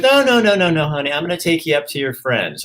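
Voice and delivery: high, insistent mock mom, sweet and firm; doting mom. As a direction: play the doting mother refusing, sing-song. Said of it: "She goes".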